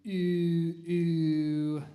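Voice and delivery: powerful sustained ooh; strong held oohs. Shown as a demonstration. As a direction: belt the long oohs. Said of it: "No, it'll be ooh".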